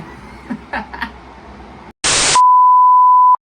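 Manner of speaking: big shrill burst of laughter; sudden high laugh; loud laugh. Real, not acted. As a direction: cackle out loud at the start. Intro cackle.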